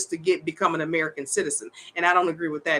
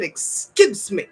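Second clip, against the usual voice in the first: indignant; 4 dB louder, much slower than usual. Retold or imitated. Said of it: "I said".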